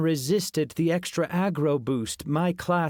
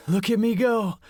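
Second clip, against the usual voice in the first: grinning; 9 semitones higher, 4 dB louder than usual. A narrator's reading. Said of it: "he grinned".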